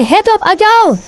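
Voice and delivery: high rallying cry; slogan call. Real, not acted. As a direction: lead the crowd with a high-pitched cheer. Leading the crowd in slogans. (pekik yel-yel bernada tinggi)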